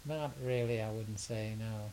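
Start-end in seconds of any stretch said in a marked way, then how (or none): none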